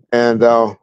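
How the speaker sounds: ordinary delivery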